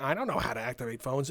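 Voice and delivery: low, soft, mock-indifferent reply; unhelpful coworker. Coworker refusing to help with customers, low mock-indifferent reply. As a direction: imitate a coworker who won't bother, voice dropped.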